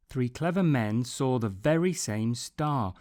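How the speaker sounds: usual speaking voice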